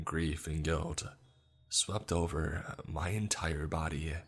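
ordinary delivery, nothing marked

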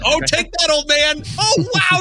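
high, loud gleeful cheering; excited cheer. Real, not acted. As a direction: cheer like a fan at a kill. Cheering at trailer kill.

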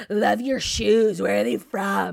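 loud, drawn-out mock drunk yell; imitated drunk screaming. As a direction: holler it like a tipsy stranger in someone's face. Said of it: "screaming in my face".

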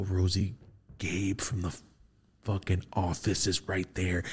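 soft, hushed urgent telling; urgent whisper. Retold or imitated. Pointing out the actor to his wife, hushed urgency. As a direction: keep it low so he won't hear. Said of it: "I go".

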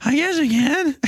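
put-on high giggly defeated kid; high laughing Buster. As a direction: cave in with a nervous laugh.

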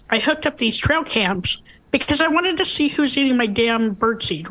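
ordinary delivery

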